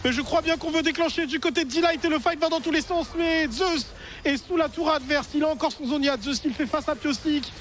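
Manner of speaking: high energetic play-by-play; esports caster. Real, not acted. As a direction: call the fight with rising urgency. Caster calling a teamfight.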